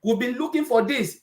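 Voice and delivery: high, eager customer voice; light mock excitement, pitch jumping up; retelling delighted customers calling out; excited customers. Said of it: "customers voiced thanking the team".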